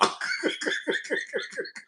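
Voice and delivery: high, soft breathless laughter; long quiet cackle at your own story; amused laugh. Real, not acted. Storyteller cracking up at what he told sister, laughing.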